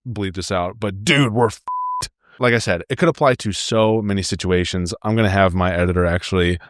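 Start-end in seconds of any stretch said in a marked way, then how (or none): 1.0-2.1 slow mock gravelly voice, pitched up. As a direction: imitate a gravel-voiced guy, drawn out. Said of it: "does this really gravelly voice like".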